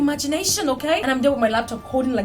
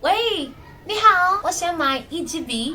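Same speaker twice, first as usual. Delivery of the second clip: slow, higher, over-careful learner; mock careful Chinese; 6 semitones higher, slower than usual. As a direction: spell it out for a stranger. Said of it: "You're like".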